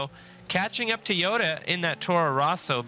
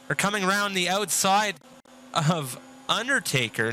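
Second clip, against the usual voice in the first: rising excitement, higher and faster as the overtake unfolds; motorsport commentator; 6 semitones higher than usual. Real, not acted. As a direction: call the three-wide moment with building hype. Three wide into Wall of Champions.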